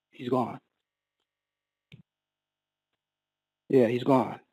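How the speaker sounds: low, soft and final; hushed bad news. Paramedics telling family of father's death, retold hushed news. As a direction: deliver the death news quietly, with pauses.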